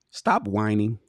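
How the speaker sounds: usual speaking voice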